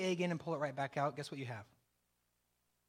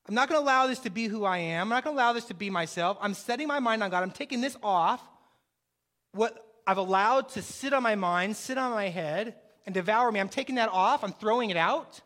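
louder, emphatic preaching declarations; full-force preaching; 6 dB louder than usual. Acted. Pastor declares victory over the mind, preaching loud. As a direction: drive each line home like a preacher.

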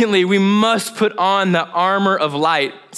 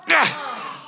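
high short cry of frustration; sudden high "ah" of stress; frustrated cry; 10 semitones higher, 4 dB quieter than usual. Retold or imitated. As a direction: yelp at the dead printer. Printer stopped, panic.

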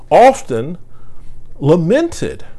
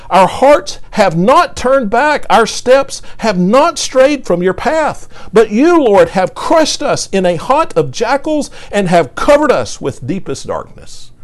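raised, slow, pleading psalm reading; preacher voicing a psalm of complaint, louder and higher; impassioned scripture reading; 6 semitones higher, 3 dB louder than usual. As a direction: read the lament as a cry to God. Reads psalm of lament aloud.

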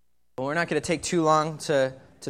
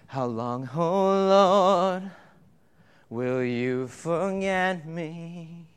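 psalm reading; much slower than usual. Acted. Reading the psalm, slow and drawn.

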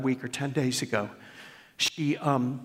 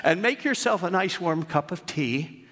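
raised, slower storybook reading voice; reading aloud; 10 semitones higher than usual. Acted. Pastor reading his daughter's tribute to the church, raised storytelling read. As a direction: read it like a storyteller opening a tale.

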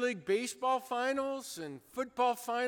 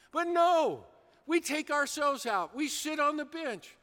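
ordinary delivery

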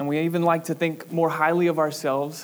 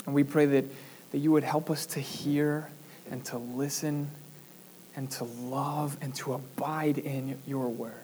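quiet prayer; 2 semitones lower, 5 dB quieter than usual. Acted. Pastor praying over the congregation, softly. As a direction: pray it quietly, winding down. Closing prayer after sermon text.

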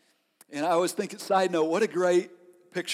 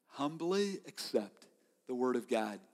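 hushed emphasis; 5 semitones lower, 10 dB quieter than usual. Acted. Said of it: "sermon key line repeated".

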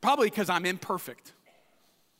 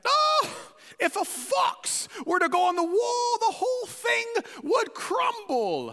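mocking English accent, much higher and shouted; 12 semitones higher than usual. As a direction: Tobiah sneering in a hammed-up English accent. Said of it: "it's in an English accent to me".

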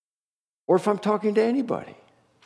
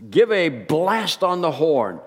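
ordinary talk

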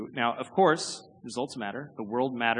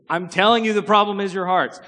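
exasperated insistence; 6 semitones higher, 8 dB louder than usual. Retold or imitated. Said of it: "He's like".

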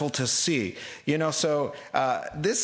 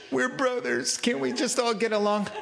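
angry sarcasm; 6 semitones higher than usual. Pretend. Preacher mocking the tribes' sermon, sarcastic and laughing. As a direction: scoff at their sermon, half laughing. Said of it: "I'm getting angry reading this, going".

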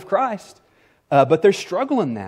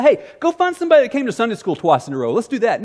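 quick, higher, laughing send-up; lightly mock a lazy pick; mock careless suggestion; 6 semitones higher, 3 dB louder, faster than usual. Apostles imagined picking anyone, mock flippant. Mocking a lax choice of deacons.

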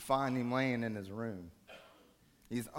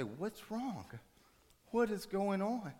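hushed, higher-pitched worried plea; concerned parent; 9 semitones higher, slower than usual. Acted. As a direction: gently coax a weeping daughter. Parent holding weeping daughter asks.

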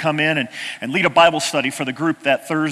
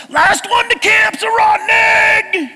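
much higher, loud, slow drawn-out shout; playful yell; 6 dB louder, slower than usual. Retold or imitated. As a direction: call the race out across the river. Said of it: "you yell to all of those boys".

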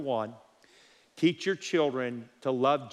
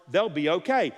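usual speaking voice

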